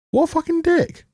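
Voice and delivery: high, incredulous blurt; shocked and disgusted at the screen; what a jerk, voice jumping way up; outraged exclamation. Real, not acted.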